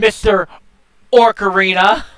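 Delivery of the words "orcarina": "Orcarina" with an R before the C; imitated mispronunciation. Calling out friend's mistake, "Orcarina" with the intrusive R. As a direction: teasingly echo "Orcarina" with its extra R. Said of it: "You said Orcarina, like, so many times".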